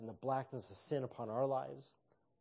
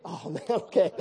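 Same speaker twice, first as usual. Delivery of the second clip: laughing; 6 semitones higher, 13 dB louder than usual. Retold or imitated. Laughing at awkward reply.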